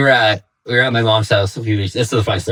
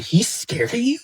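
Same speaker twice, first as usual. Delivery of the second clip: much higher, softer incredulous question; amazed disbelief; 12 semitones higher, 5 dB quieter than usual. Retold or imitated. Dad shocked the son fears his stepmom, amazed. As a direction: ask it in wide-eyed disbelief. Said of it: "I'm like".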